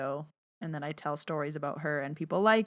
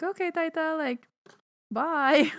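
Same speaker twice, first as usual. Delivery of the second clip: high, sing-song goodbye; high cheerful goodbye to grandma; chirpy goodbye; 10 semitones higher, 4 dB louder than usual. Retold or imitated. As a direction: wrap it up brightly to grandma. Speaker ending the call.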